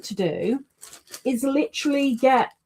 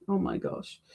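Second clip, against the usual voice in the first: breathy whisper, much softer; hushed gasp of mock alarm; whispered oh my gosh; 3 semitones lower, 10 dB quieter than usual. Real, not acted. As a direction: mutter it under your breath. Dreading brighter wheel.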